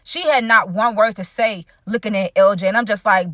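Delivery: ordinary talk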